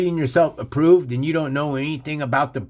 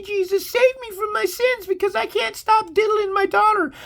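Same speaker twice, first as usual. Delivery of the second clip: very high falsetto parody of desperate believers; hammed-up, high-pitched begging to be saved; mock screaming plea. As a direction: wail mockingly for salvation in a piercingly high put-on voice. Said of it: "all of you screaming".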